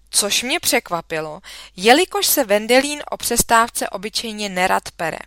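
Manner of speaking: ordinary delivery, nothing marked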